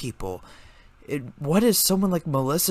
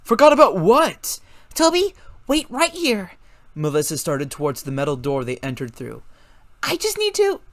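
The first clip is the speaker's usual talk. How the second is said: hurried; 5 semitones higher, 4 dB louder than usual. A narrator's reading. Toby and Melissa quick exchange.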